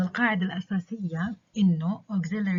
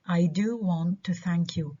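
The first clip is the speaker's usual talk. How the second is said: "do" stressed for emphasis. Shown as a demonstration. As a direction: insist through a weighted "do". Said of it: "فأنا بدي أأكد".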